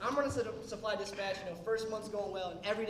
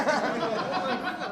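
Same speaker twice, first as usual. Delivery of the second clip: slow, drawn-out and laughing; laughing oh my God; 5 semitones higher, 10 dB louder, much slower than usual. Retold or imitated. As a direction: stretch it out through laughter. Excited reaction, laughing.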